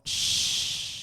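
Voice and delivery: long voiceless shush. Pretend. Cutting off co-host's Amber Heard talk, mock shushing. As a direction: hush them with a drawn-out shh.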